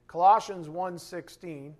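ordinary talk, nothing marked

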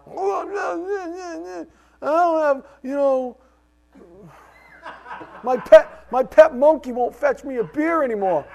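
high, drawn-out put-on whine breaking into laughter; hammed-up complaining voice, laughing through it; mock whiny complainer. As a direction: whine like a spoiled man over nothing. Mocking a petty complainer.

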